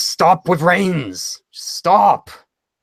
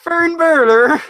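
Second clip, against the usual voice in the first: put-on Irish accent; 10 semitones higher, 5 dB louder, slower than usual. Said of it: "This is my Irish accent impression".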